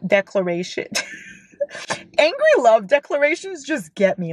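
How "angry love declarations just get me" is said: high, swooning outburst; giddy gushing. Real, not acted. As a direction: gush with helpless delight. Gushing after laughing.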